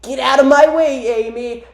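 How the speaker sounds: hammed-up confrontation; angry cartoon rival. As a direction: order them aside in a strained hero voice. Acted Silver confronting Amy.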